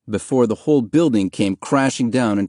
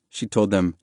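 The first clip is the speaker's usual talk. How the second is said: plain reading voice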